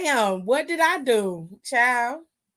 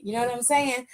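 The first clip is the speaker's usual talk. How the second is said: ordinary talk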